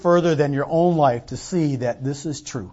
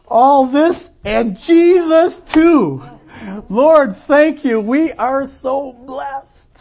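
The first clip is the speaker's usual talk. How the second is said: high, slow, loud and full of awe; grateful poor man; 9 semitones higher, 6 dB louder, much slower than usual. Acted. Poor family man thanking the Lord at dinner, high and awed. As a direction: pour out thanks over a crust of bread.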